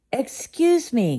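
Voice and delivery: put-on a child calling the answer out loud. A narrator's reading. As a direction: call it out eagerly. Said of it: "called Chip".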